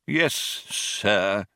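stiff, cold compliance; comply coldly. A narrator's reading. Old man answers mouse's dismissal, coldly. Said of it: "said the old man coldly".

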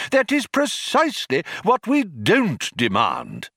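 high-pitched exasperated correction; irritated exclamation. A narrator's reading. Older philosopher corrects his colleague, irritated exclamation. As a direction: spell it out crossly. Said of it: "exclaimed Magic Thighs in irritation".

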